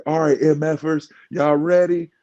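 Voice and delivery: mock announcer yell; imitating a booming hype man; retold yell. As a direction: hype it up like a loud man on a mic. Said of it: "the way he yells".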